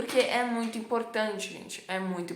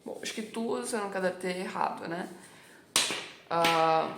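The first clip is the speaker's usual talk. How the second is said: ordinary talk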